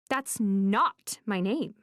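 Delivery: slow, heavy insistence on "that's not my name"; too emphatic. Acted. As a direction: press each word of "that's not my name" down hard. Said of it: "I say that a little too emphatically".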